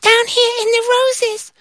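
very high, tiny squeaky ant; a wee ant piping up. Acted. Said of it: "they heard the voice".

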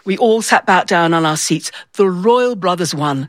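ordinary delivery

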